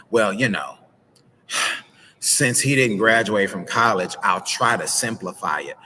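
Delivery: mock-patronising, with a heavy sigh; condescending. Shown as a demonstration. Wife talks down to husband, demonstrating a condescending way. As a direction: sigh and talk down to them. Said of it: "if you're speaking in a condescending way".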